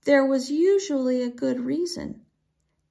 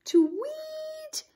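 bird tweet. Acted. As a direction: chirp it back like a bird. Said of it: "echoed all the girls".

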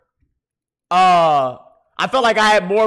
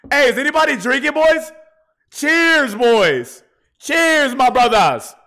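excited toast; 6 semitones higher, slower than usual. Real, not acted. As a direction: raise a glass and call out. Drunk toast to chat.

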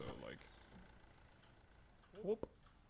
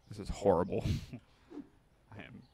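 louder frustrated groan of a line; exasperated outburst; 11 dB louder than usual. Real, not acted. Streamer frustrated at misplaced bread, louder. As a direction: let it burst out in exasperation.

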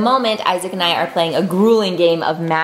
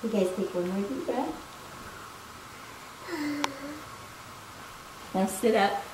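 soft bedtime yoga guidance; hushed, unhurried exercise leading; yoga instruction; 15 dB quieter than usual. Real, not acted. Leads kids' bedtime yoga.

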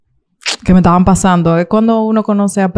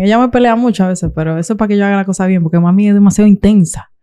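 normal talking voice

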